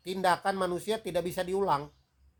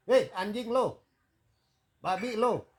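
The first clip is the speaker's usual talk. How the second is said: raised, higher-pitched pretend swearing; mock angry cursing; 4 semitones higher, 5 dB louder, slower than usual. Lecturer acts out cursing someone on the road, mock angry. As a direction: hurl the insults as a staged outburst. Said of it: "marahin orang ya".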